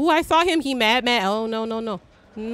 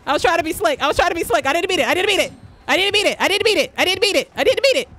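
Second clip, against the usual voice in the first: frantic pleading; 7 semitones higher, much faster than usual. Real, not acted. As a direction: beg over and over in a rush. Pleading repeats while being chased.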